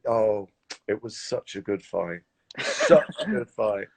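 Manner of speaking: slow, drawn-out mock soothing; imitating the director. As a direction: stretch it out like a gentle letdown. Director soothing actor, retold.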